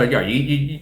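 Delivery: ordinary delivery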